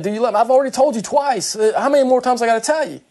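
fed up at being asked yet again; aggravated, frustrated. Retold or imitated. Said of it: "I think he got a little aggravated, a little frustrated".

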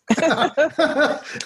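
quick bright laugh, high pitched; a real chuckle; short laugh. Real, not acted. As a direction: let a laugh burst out at the joke. Laughs at his own joke.

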